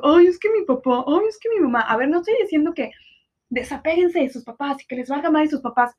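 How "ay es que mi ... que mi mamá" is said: higher, whiny voice of a grown-up still leaning on parents; whiny mock grown kid. Mocking twenty-somethings who won't stop quoting their parents, high and whiny. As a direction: sneer it as a spoiled twenty-something. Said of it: "una persona de veintitantos esté diciendo".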